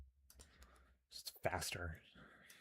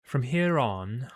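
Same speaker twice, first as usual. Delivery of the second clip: slow, higher, louder British villainess; British accent; 5 semitones higher, 9 dB louder, much slower than usual. Acted. Commander begins new orders, British accent. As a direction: draw it out imperiously in a British accent. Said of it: "I gave her a British accent".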